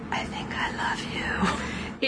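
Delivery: soft, unvoiced swoon; mock-dreamy whispered love confession; lovestruck whisper. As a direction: breathe it like a secret confession. Dirk swooning over Hobbs.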